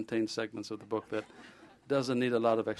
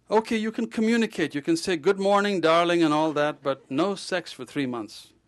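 playful doctor; 6 semitones higher, 7 dB louder than usual. Retold or imitated. Doctor jokingly corrects the patient.

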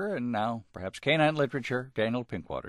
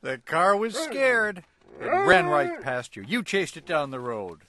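bright, higher, louder read-aloud; storybook reading; 6 semitones higher, 3 dB louder than usual. A narrator's reading. Host taking his turn reading the dog story, animated. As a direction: read it big for a child listener.

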